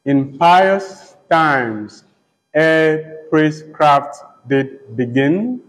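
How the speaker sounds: very slow, spaced-out verse reading; slow recitation. Shown as a demonstration. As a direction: stretch each word so the meter shows. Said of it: "just note, um, the lines".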